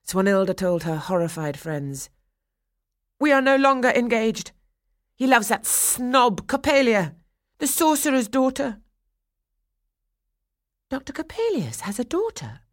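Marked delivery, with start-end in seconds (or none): 3.2-8.8 high, loud, scornful outburst; indignant announcement. A narrator's reading. Swanhilda telling her horrified friends, loud and indignant. She breaks off engagement before friends. 10.9-12.6 high, astonished question; surprised. A narrator's reading. One girl asking about Coppelius, in surprise. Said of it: "asked one girl in surprise".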